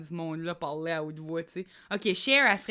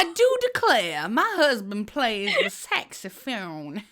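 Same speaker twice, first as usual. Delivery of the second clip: slow mock Southern belle accent, a bit louder; 4 dB louder, slower than usual. Copying her friend's Southern belle line, slow. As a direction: drawl it back like a Southern belle. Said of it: "cette fille qui vient du Sud".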